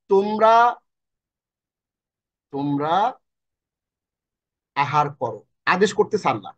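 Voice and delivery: ordinary talk, nothing marked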